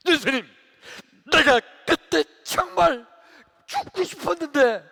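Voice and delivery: high, loud acted accusation; defiant outcry. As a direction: throw your despair at Jesus, word by word. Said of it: "이렇게 막 대들었는데". (크고 높게 대들듯)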